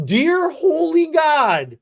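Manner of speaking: slow, high dear holy God; drawn-out stunned exclamation; shocked. Real, not acted.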